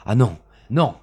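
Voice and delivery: loud, high no; desperate cry. Acted. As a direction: beg in a panic. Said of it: "cria Bellingham".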